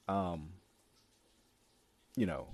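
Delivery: normal talking voice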